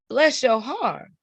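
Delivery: high, slow Southern "bless your heart"; sugary Southern politeness hiding an insult, pitched high. Acted. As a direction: draw it out like a Southern lady. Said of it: "So down south, she said".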